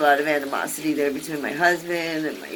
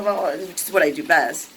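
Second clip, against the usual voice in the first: ordinary talk, nothing marked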